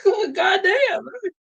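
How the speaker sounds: high, cracking laugh-cry of disbelief. Real, not acted. Streamer howling at the roast, high laughing wail.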